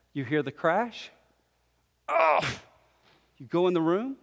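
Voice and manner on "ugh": sudden high "ugh"; short acted groan of dismay; exasperated groan. Parent hearing the crash, high exasperated groan.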